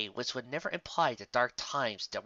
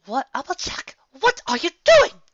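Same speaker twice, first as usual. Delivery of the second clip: much higher, louder posh alarm; fancy-sounding voice; 13 dB louder than usual. Acted. A fancy pony catches Applejack attacking, shocked. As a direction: cry out in refined shock. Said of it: "A very fancy-sounding voice".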